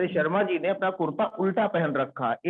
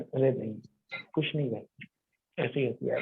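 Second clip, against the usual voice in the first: hushed denial; 6 semitones lower, 5 dB quieter than usual. Retold or imitated. Friend's quiet denial.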